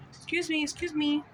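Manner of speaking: mock call to get his attention. She calls the homeless man from across the street, high. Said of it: "shouting his name".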